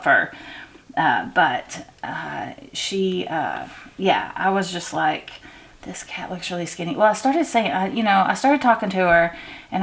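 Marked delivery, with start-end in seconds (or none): 5.8-6.9 whispered thought. Retold or imitated. Startled thought about the thin cat.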